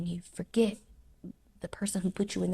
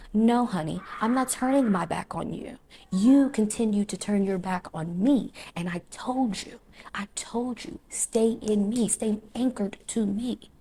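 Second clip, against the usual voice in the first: God answering; 3 semitones higher, 5 dB louder than usual. Acted. Voicing God answering the believer.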